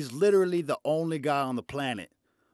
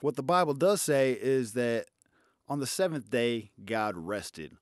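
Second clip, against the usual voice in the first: usual speaking voice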